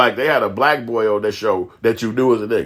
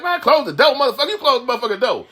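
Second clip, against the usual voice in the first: put-on high-pitched, tough-talking kid; imitating Dudley; 12 semitones higher than usual. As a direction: mouth off as a foul-mouthed child character, pitched high. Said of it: "Dudley be like".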